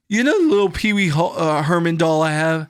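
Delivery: high, loud hurt dude voice; mock wounded friend. Hurt friend complaining about his home, high and aggrieved. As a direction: whine about being disrespected.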